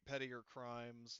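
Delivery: ordinary talk, nothing marked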